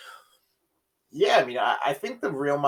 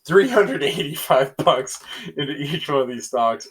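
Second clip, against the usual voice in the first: laughing correction; 3 semitones higher, 6 dB louder than usual. Real, not acted. Laughs at his own slip.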